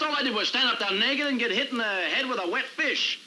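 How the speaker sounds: high-pitched, aggrieved outburst; worked-up, exasperated grievance; indignant complaint. Acted. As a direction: protest bitterly, pitch riding high. Indignant complaint in acted quarrel.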